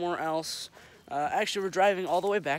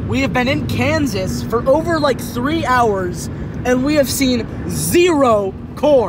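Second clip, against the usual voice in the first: mock outraged shout; 5 semitones higher, 12 dB louder, slower than usual. Mock outrage over no corn.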